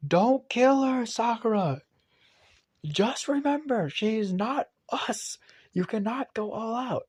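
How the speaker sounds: high, loud urgent yell, slowed on key words; Sasuke shouting a warning across the arena. Retold or imitated. Sasuke yelling at Sakura from the crowd, high and loud. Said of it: "yells out".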